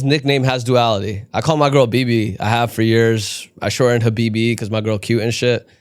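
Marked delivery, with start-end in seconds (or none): none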